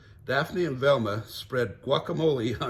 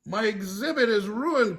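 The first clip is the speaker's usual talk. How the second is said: high, slightly loud dismay; upset museum curator; curator crying out; 9 semitones higher, 4 dB louder than usual. Acted. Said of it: "cried Lotta".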